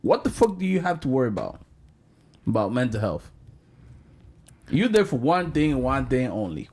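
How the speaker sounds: high, heated scolding; exasperated rant. Real, not acted. Host berating an athlete over priorities, exasperated. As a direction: demand an answer, loud and incredulous.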